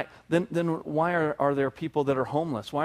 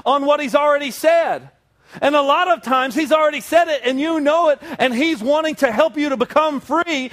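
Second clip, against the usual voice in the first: higher, louder, driving sermon; full-force preaching; 7 semitones higher, 4 dB louder than usual. Acted. Preacher pressing his church with rising force. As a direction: preach it hard to the congregation.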